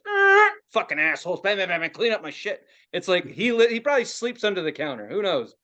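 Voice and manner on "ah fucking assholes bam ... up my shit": OCD outburst. Acted. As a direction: act out an exasperated compulsive fit. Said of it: "My O C D be like".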